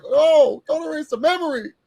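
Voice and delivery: high, hammed-up tearful plea; mock wail. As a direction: pitch way up and wail it like a crying fan. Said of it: "I was like".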